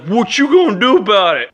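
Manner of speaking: high, loud mock tough-guy challenge. Smoker challenging the comic, high and loud. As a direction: sneer at him like a beach bully. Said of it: "And he was just like".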